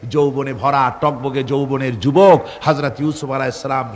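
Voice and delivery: ordinary talk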